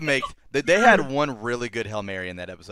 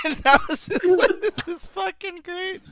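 laughing. Real, not acted. The speaker cracking up at the robot scene. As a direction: laugh it out high.

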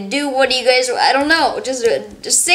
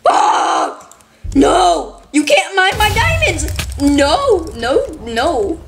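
high, slow, indignant yelling at a diamond thief; triggered yelling; 5 semitones higher, much slower than usual. Real, not acted. Gamer yelling at a kid who mined her diamonds, high and slow. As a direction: scold him, stretching every word. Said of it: "I was triggered".

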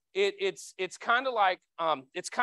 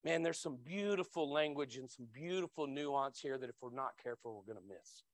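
much softer, lower, fading to almost nothing; hushed aside; 5 semitones lower, 9 dB quieter than usual. Real, not acted. Preacher hushing to draw the congregation in, quiet. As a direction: lean in and drop the voice. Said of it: "drops voice for emphasis".

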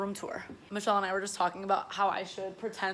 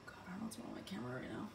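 whispering; 10 semitones lower, 18 dB quieter, much faster than usual. Real, not acted. Muttering at camera, unvoiced.

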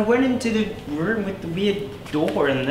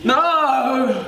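anguished no; 10 semitones higher than usual. Acted. Told parents are dead.

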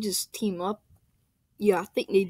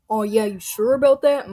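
louder, challenging repeat; loud taunt; 8 dB louder than usual. Acted. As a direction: push the taunt harder. Taunting rival about Yoshi.

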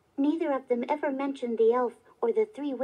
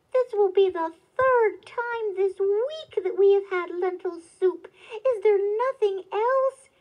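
raised, exasperated protest; crying out complaint; 6 semitones higher, 5 dB louder than usual. A narrator's reading. Woodcutter protests to his wife about supper, loudly exasperated. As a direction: complain loudly at a higher pitch. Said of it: "cried the woodcutter".

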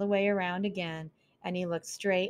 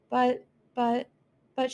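frightened stammer, higher and louder; 6 semitones higher, 5 dB louder than usual. Acted. Mouse realising owls eat mice, scared higher stammer. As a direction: stumble over the first word in fright. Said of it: "she stammered".

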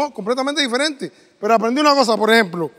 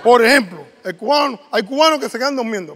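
usual speaking voice

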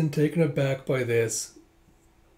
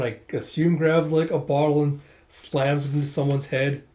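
normal talking voice

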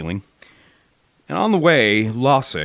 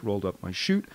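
plain reading voice

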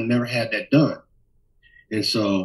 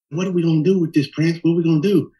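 higher, fussy flamboyant voice; flamboyant hairdresser; 7 semitones higher, faster than usual. Retold or imitated. Hairdresser [name] asking [name] about the hair, higher fussy voice. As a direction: fret theatrically over the hair. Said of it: "very flamboyant".

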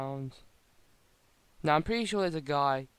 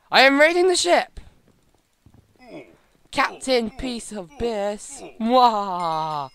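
loud, higher swaggering pirate; pirate bravado; 5 semitones higher, 9 dB louder, much slower than usual. Acted.

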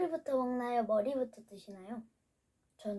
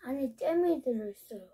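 speaking between bites; talking while chewing. Real, not acted. Seen 0: Ate the filled treat just before; lips move, pursed while talking.

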